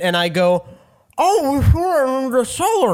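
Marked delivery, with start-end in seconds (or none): 1.2-2.9 words round a bite of bread; mouth full. Acted. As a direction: talk through a mouthful. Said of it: "talking with your mouth full bit".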